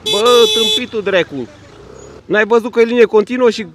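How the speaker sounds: loud, high road-rage outburst; furious scolding at full voice; real angry shouting. Real, not acted. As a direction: yell accusations at the other driver. Quarrel with a driver over lanes.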